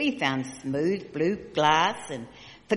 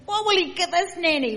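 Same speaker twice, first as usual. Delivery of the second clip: put-on eager children; 10 semitones higher, faster than usual. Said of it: "we asked".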